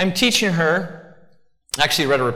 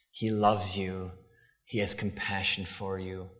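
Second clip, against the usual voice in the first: mock lovey-dovey; 6 semitones lower, 11 dB quieter than usual. Preacher mocking the soft fluffy Jesus, syrupy and hushed. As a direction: coo it like a sappy greeting card. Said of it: "fluffy, wonderful, lovey things".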